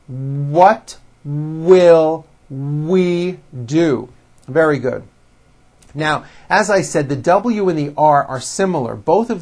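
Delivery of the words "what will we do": very slow, with lips pushed forward on each W; slow careful W. Shown as a demonstration. Teacher modelling forward-mouth W words. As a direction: stretch out will and round the lips. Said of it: "Say it with me".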